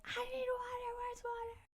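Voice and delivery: high, strained mock panic; frantic imitation. Friend running over desperate for water, frantic imitation. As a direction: squeeze it out high and thin.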